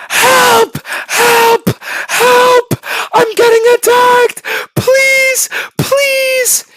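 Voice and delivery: very high, loud panicked screams; screaming for help. Acted. Inmate under attack at night, screaming for help. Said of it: "This guy's screaming every night".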